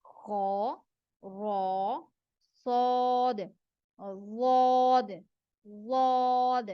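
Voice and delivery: "खौ", "रौ", "सौद", "वाद" each slow and full-mouthed; teacher reviews the heavy letters; heavy letters recap. Shown as a demonstration. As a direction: run through the heavy letters. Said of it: "चार जो है मोटे हरूफ पढ़े हैं".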